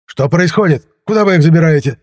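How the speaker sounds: high, loud indignant questions; agitated. A narrator's reading. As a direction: demand answers in agitation. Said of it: "Возбуждённо размахивал он руками". (возбуждённо, высоко и громко)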